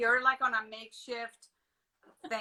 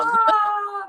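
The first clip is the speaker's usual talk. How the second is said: delighted gasp; 10 semitones higher, 13 dB louder than usual. Real, not acted. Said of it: "bless you".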